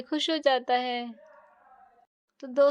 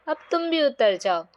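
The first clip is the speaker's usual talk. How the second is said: plain reading voice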